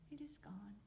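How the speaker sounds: whispered confession. Acted. Woman admitting to Foxy the bee is gone, whispered. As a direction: breathe it out guiltily.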